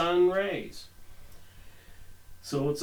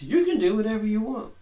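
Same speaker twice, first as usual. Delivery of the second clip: mocking; 5 semitones higher, faster than usual. Mocking occult belief.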